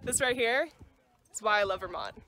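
ordinary talk, nothing marked

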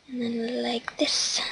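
slow whispered murmur. Real, not acted. Building the stairs, slow whisper to herself. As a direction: slowly whisper while placing a block.

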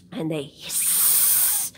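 slow, breathy hissing words; lizard hiss. Acted. Storyteller shows the children a lizard's hiss.

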